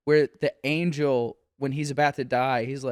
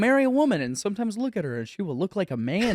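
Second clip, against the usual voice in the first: mock prophecy; 5 semitones higher, faster than usual. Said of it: "Americana band, like, You will go out".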